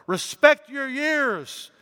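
put-on high, loud, slow plea to an old man; exclaimed. A narrator's reading. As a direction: cry it out high and slow like a Roman official. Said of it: "he exclaimed".

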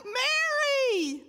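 high, loud, tender cry of her name; a cry of love; Jesus calling her name. Acted.

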